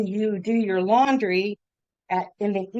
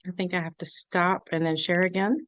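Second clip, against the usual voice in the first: ordinary talk, nothing marked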